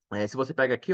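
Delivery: ordinary talk, nothing marked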